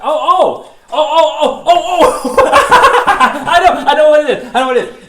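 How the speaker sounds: high, loud, laughing outbursts; squealing with laughter as it clicks; excited laughing. Acted. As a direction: burst out with delighted discovery. Guessing the costumed visitor, thrilled.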